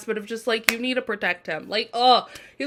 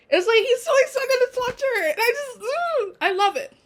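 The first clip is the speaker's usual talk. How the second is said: very high, giddy fangirl squeal; squealing with excitement; 13 semitones higher than usual. Real, not acted. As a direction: gush like you can't contain yourself. Gushing over his shy smile.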